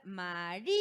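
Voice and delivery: María pulled apart as "ma-RÍ-a"; broken into syllables. Shown as a demonstration. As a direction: stretch the RÍ of María, then a separate A. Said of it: "¿Cómo se pronuncia esa palabra?".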